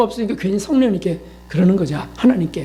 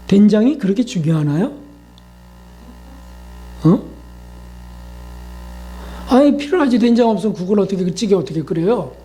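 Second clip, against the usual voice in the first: usual speaking voice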